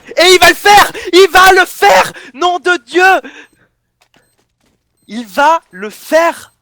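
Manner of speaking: real shout of disbelief at a clutch play; ecstatic scream. Real, not acted. Caster screaming as Shox wins the one-versus-one, ecstatic shout. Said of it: "pour mon cri".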